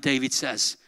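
ordinary talk

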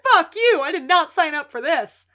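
loud, high angry yell; angry shout. Retold or imitated. Lip yelling at the Milkovich boys, angry. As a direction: snap at them and bail out. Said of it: "He's like".

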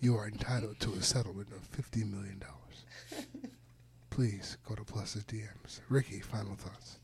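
whispered like a drug-injury ad; hushed legal-ad voiceover read; mock commercial whisper. Mocking the podcast outro as a whispered lawsuit ad. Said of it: "started out like a mesothelioma commercial".

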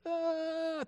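high-pitched imitated wail; sustained high cartoon crying; mock child's cry. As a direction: wail like a little kid running off. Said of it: "She cries".

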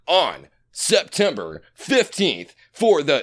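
slow, stretched announcer with big swoops; hammed-up car-show announcer; event emcee voice. Shown as a demonstration. Podcaster plugging a show date, drawn-out emcee voice. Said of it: "to do that voice".

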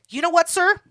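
loud, indignant retort; mock defiance. Rejected clubber to the bouncer, loudly defiant. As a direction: tell him off loudly as you leave.

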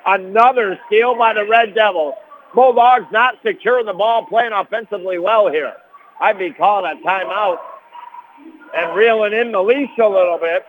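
thrilled radio announcer; excited call. Real, not acted. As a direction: ramp up as the steal happens. Sudden steal in close game.

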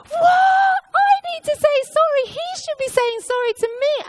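outraged disbelief. Retold or imitated. Reacting to being told to say sorry, outraged and loud. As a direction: shriek out the protest at the unfairness. Indignant reply to Holy Spirit.

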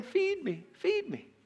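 high, small mock-baby whine; crying like babies. Moses mocking his hungry people, crying like babies. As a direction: mimic a whining baby begging, softly and high. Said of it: "They keep crying like babies".

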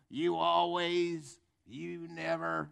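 slow, dragged-out accusing whine; mock nagging spouse. Spouse accusing partner in marriage row, mock drawn-out nagging. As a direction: stretch each accusation out mockingly.

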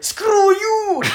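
high, loud laughing yell; mock action-hero line. Retelling the film, laughing mock Arnold yell. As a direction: fling it like a cartoon hero. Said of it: "ja sit se on silleen".

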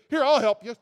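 eager offer of help. Pretend. Jesus reaching to sinking Peter, loud and eager. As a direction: call out to help right away.

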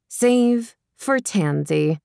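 ordinary delivery, nothing marked

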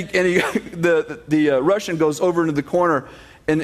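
normal talking voice

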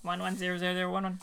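fast, soft robot binary; robot saying binary. Pretend. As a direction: rattle off binary like a machine. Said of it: "Let's get some robot on".

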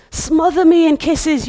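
fervent loud verse reading; passionate cry. Acted. Preacher reading passionate love song.

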